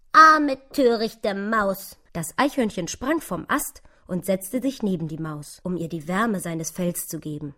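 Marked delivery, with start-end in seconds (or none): 0.1-2.0 loud, slow, drawn-out pity; mitleidiger Ausruf. Acted. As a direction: exclaim slowly in pitying reproach, pitched up. Squirrel pities the lost mouse. (laut, langsam und bedauernd)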